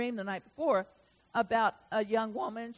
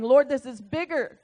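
raised, pleading cry to God; loud pleading prayer; 7 semitones higher, 9 dB louder than usual. Retold or imitated. Preacher retelling his loud prayer to God. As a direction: call out to the Lord, begging to know.

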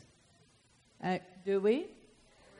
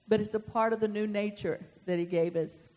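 usual speaking voice

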